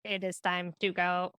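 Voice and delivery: measured, mechanical robot voice. Shown as a demonstration. Coach demonstrating a fun way to hurry kids, robot voice. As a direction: say it word by word like a robot. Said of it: "The robot's always good".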